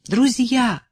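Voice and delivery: put-on elderly singer's high exclamation; lofty, theatrical cry; exclaiming. A narrator's reading. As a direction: call out to friends, pitch lifted high. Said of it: "воскликнула пожилая певица".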